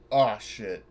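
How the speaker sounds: usual speaking voice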